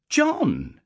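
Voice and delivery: a mother calling out in alarm; startled cry of the name. A narrator's reading. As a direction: call his name, suddenly worried. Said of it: "she cried in some alarm".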